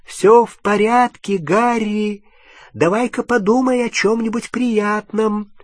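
high gentle encouragement; reassuring. A narrator's reading. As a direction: soothe and encourage. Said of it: "ободряюще сказала Полумна".

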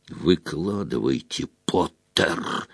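low, quiet menacing growl; fury held down low in the throat; restrained roar. A narrator's reading. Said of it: "взревел он".

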